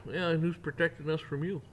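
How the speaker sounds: ordinary talk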